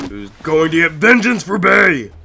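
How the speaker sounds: hammed-up high, loud war cry; rally the troops for revenge, high and loud; over-the-top vengeful shout; mock battle cry.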